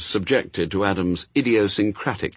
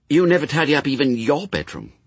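put-on Pepper, chiding child; 7 semitones higher, 3 dB louder than usual. A narrator's reading. Pepper needles Adam.

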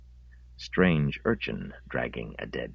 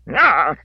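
startled cry; 11 dB louder than usual. Acted. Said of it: "said Harrogate when he glimpsed".

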